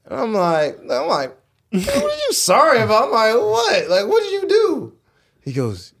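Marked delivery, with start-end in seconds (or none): 1.7-4.9 very high-pitched incredulous questioning; baffled demand. Retold or imitated. Player demanding why his friend is sorry, high and incredulous. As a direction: shoot the pitch way up, exasperated. Retold baffled reply to apology.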